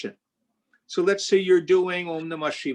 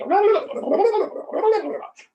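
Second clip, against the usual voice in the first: laugh; 5 dB louder than usual. Real, not acted. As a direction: burst of high laughter. Teacher laughs at student.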